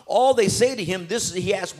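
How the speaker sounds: ordinary delivery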